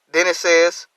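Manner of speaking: ordinary delivery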